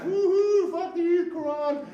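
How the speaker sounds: very high, loud party whoop; hammed-up frat-boy cheer, slowing on the last words; rowdy guys whooping. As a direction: holler like drunk soldiers at a beach. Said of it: "the guys are all like".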